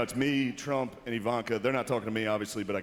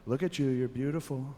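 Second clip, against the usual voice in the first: Trump impression; 3 semitones higher than usual. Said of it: "His Trump impression is actually really good".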